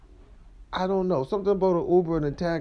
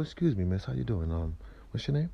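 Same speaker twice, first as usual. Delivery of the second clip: low, soft come-on to a woman; hushed smooth pickup line; 5 semitones lower, 6 dB quieter than usual. Retold or imitated. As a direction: sweet-talk her softly the first time you meet. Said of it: "what I mean by whisper".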